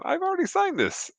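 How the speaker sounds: very high mock-puzzled squeak; a hammed-up baffled celebrity; imitating Nimoy, puzzled. Nimoy tells the returning boy, in a high mock-baffled voice. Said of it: "he's there going".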